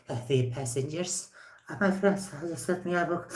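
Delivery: soft mock pilot announcement; seductive pilot voice.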